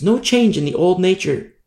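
usual speaking voice

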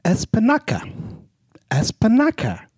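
high, exclaimed comic Italian voice; theatrical Italian-style proclamation; mock Italian. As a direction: cry it out in a put-on Italian accent. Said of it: "it'll end up Italian".

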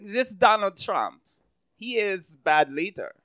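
put-on slow, halting German-accented English. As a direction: read it out word by word like a stiff German tourist. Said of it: "I don't know if that's a good German accent".

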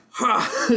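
sharp, high, indignant "Ha!"; outraged gasp. Retold or imitated. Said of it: "She gets so mad".

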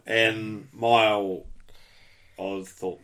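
usual speaking voice

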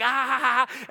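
high-pitched mock laughing; imitated laugh. Dad joking with his kids as he runs upstairs, mock laughing. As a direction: act out a playful laugh. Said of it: "I'm, like, laughing".

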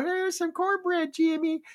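high-pitched put-on voice; imitated table talk. Imitating talk over dinner at the aunt's, high put-on voice. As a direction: mimic someone offering food, way up high.

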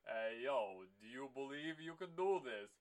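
a hint of a Stallone drawl. Stallone asking Schwarzenegger before the movie, light Stallone voice. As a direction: slur it like a mock Stallone. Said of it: "if Stallone and Schwarzenegger are just sitting around going".

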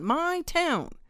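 my town, cried out high and loud; high loud repeat. A narrator's reading. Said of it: "kept on complaining".

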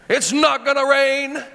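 booming pro wrestler growl; the Rock impression. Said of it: "That's my best rock impression".

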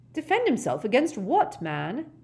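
high, loud, outraged question; exasperated official protesting; indignant. Acted. Ogden clambering to his feet, indignant.

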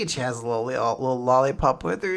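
normal talking voice

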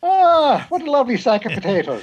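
high, loud stage-Irish exclamation; Irish accent. Pretend. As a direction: ham up an Irish brogue. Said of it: "You can't do an Irish accent".

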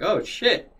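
high, startled "Oh, shit"; shocked oh shit. Retold or imitated. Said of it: "I'm like".

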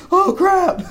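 high, laughing yelp of shock; mock panic. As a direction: play up the sudden panic, pitched high. Retold shock at being called over.

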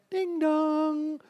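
high, slow sing-song chime; doorbell imitation.

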